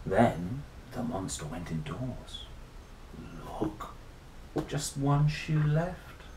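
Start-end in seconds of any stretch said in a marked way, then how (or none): none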